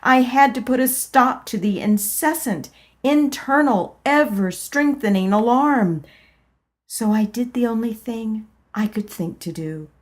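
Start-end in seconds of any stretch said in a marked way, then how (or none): none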